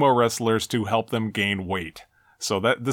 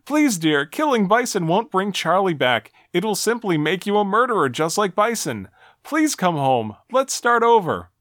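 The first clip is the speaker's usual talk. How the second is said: lightly voiced wife, raised pitch; a hint of a higher, pleading female voice; 7 semitones higher than usual. Wife begging husband to spare Bison, lightly acted pleading. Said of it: "Jane continues her pleading".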